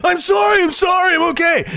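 high shouted panicky apology; frantic begging. Retold or imitated. Beaten man begging the attacking cat to stop, frantic. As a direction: plead for mercy at the top of your voice.

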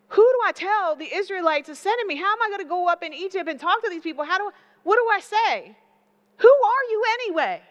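a frantic Moses, voice climbing to a near shout; panicked Moses. Acted. Moses panics, questions God.

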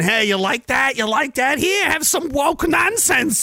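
rising, high-pitched mock salesman; pushy peddler. Voices show makers pushing content.

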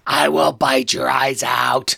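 slow, breathy monster whisper; menacing dinosaur whisper. Acted. Devil Dinosaur answering Hank's petting with a slow whispered threat.

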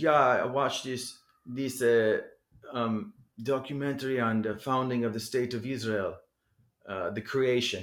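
put-on lightly done German accent retelling his words. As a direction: voice the German street musician in a German accent. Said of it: "I'm imitating a German accent".

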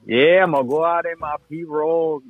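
demonstrated Cajun accent, vowels pulled long; drawn-out Southwest Louisiana Cajun accent. Shown as a demonstration. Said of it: "they draw out their words more".

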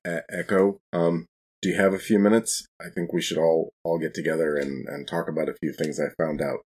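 usual speaking voice

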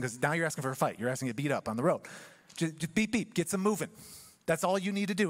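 ordinary delivery

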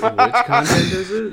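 high, laughing pun on witch; asking through a laugh, drawn out; laughing question. Real, not acted. Punning on which content.

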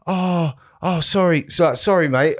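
high, sheepish mimicked sorry; mock apology. Intruder apologises to the golfer, high mock sheepish voice. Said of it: "And he's like".

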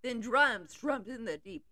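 usual speaking voice

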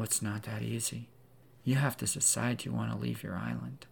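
usual speaking voice

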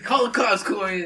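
high, loud exasperated order; angry, frustrated yell. Retold or imitated. Jerry ordering his coworker during the confrontation, angry yell. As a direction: yell it as the fed-up older man. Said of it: "Jerry gets all fucking angry and frustrated".

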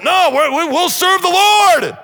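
high, loud, slow insistence; people insisting. Retold or imitated. Congregation acting the people insisting, loud slow. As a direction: rise up and insist. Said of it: "you all were to rise up".

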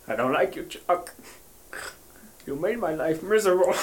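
half-hearted, hushed mock sorrow; attempted crying. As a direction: try to work up tears, quietly. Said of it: "to cry for you".

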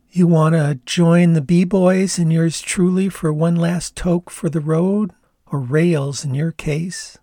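put-on lazy, teasing Canadian accent. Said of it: "in her Canadian accent".

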